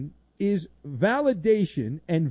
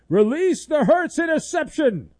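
mock villain order; 11 semitones higher, 8 dB louder than usual. Villain executive giving order.

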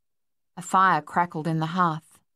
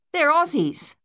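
much higher, indignant exclamation; loud complaint; 10 semitones higher, 4 dB louder than usual. A narrator's reading. Harold spotting who the rival swimmers are, high-pitched complaint. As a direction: call it out high in protest. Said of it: "Harold complained".

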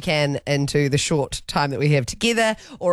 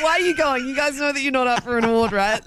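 higher-pitched imitation of a doubter; mock puzzled questioner; 7 semitones higher than usual. Imagined organisers question the hosts' attendance, mock puzzled. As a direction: query them in a raised mock voice.

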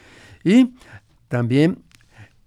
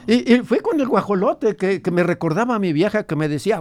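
lightly voiced grandfather, a bit higher; old grandfather; 7 semitones higher than usual. Grandfather recalls his ranch for the radio host, lightly voiced old man. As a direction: tell it as the old abuelo remembering his ranch.

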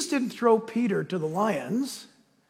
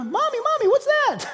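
put-on high, small child's voice, much higher; baby zebra voice. Baby zebra asking its mother about the lion. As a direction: play the scared little zebra calling mommy. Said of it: "a little baby zebra".

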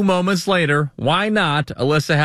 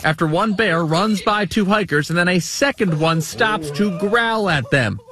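news read; 3 semitones higher than usual. Acted. News anchor report.

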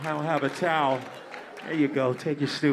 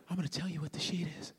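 whispering; 10 dB quieter, much faster than usual. Real, not acted. Confiding aside to audience.

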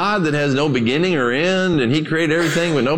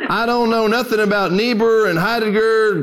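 put-on old preacher; 6 semitones higher than usual. Old preacher voiced in story.

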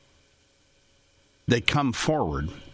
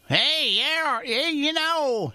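high, slow drawn-out Festus twang; much higher put-on cowboy sidekick voice; Festus impression; 14 semitones higher, much slower than usual. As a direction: stretch it out like the TV deputy. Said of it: "he doesn't even talk like that".

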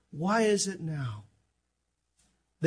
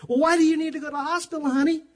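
higher, quick mock wife voice; wife's puzzled question; 10 semitones higher, 5 dB louder, faster than usual. Wife asking husband why he needs the hospital, high and quick. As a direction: play the puzzled wife, fast. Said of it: "She goes".

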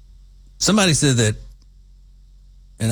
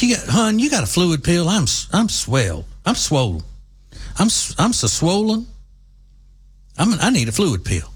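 fretful relative, imitated; 8 semitones higher than usual.

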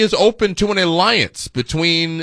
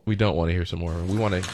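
a fast, lower aside correcting yourself; fast low mutter; 8 semitones lower, 7 dB quieter, much faster than usual. Real, not acted. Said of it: "no, I said we don't wanna".

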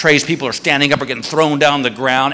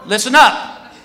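raised-voice announcement to a whole line; calling out; 5 semitones higher than usual. Retold or imitated. Security guard to the whole airport line, calling out. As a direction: call out higher, addressing the crowd.

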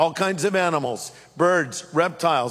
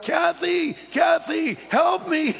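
high-pitched mock cry for help; retold yell for help; 10 semitones higher, slower than usual. Preacher reenacts calling Kathy to the forest fire, high retold yell. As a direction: act out yelling to your wife, pitch way up. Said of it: "I'm yelling out".

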